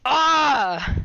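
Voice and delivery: short loud high cry; high yelp. Real, not acted. Player crying out at the screen, loud high yelp. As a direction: let out a startled yell.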